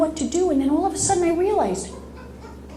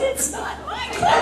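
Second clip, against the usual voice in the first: much higher, laughing squeak; high laughing denial. Retold or imitated. Telling the plow driver on the phone, high and laughing. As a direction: squeal it in giddy relief. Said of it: "I said".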